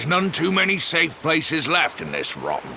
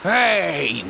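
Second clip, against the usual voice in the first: normal talking voice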